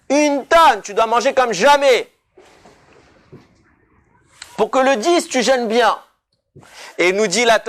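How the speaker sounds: high, loud, driving sermon voice; preaching at full force. Acted. Rabbi urging his listeners to feast, in full preaching voice. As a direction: preach it out, rising with fervour. Preaching the Yom Kippour eve meal.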